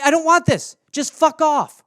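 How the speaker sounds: usual speaking voice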